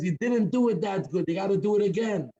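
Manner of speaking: high-pitched heckler's shout, held back; half-shouted heckle from the bleachers; mock shouting. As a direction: call out a complaint like a heckler. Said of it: "shouting at the top of his lungs".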